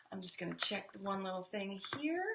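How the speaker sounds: hushed aside while checking; whispered aside. Real, not acted. Teacher checking something on screen, whispering. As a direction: whisper it to yourself.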